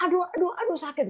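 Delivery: high-pitched mock cries of pain; imitated pained cries. Sick client lifting her leg, imitated cries of pain. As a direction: imitate a patient crying out, without shouting. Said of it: "itu dia teriak-teriak".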